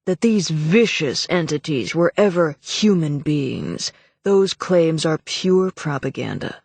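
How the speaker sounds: cold indignant official voice. Acted. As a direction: dismiss it with contempt. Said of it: "Morland was saying indignantly".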